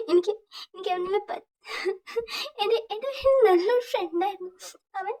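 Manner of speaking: high, broken voice, sobbing through the words; crying. Acted. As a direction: choke out the grief for a lost friend. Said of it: "J K അവിടെ ഭയങ്കര കരയാണ്".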